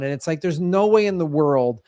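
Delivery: ordinary delivery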